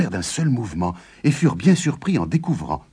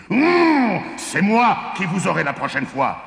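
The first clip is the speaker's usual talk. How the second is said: high, strained giant growl into a shout; enraged shout; 11 semitones higher than usual. A narrator's reading. Giant vows to win next time, shouting in rage. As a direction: vow revenge in a piercing rage. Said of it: "Le géant explosait de rage".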